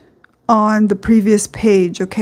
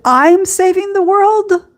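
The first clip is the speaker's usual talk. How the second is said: much higher, mocking her own ego's boast; mock grandiose ego; 9 semitones higher than usual. Preacher mocks her ego's savior boast, high and ironic. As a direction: send up the heroic ego, hammed up. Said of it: "my psychological ego says".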